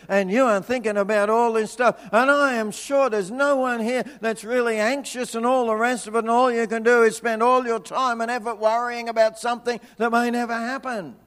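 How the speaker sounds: usual speaking voice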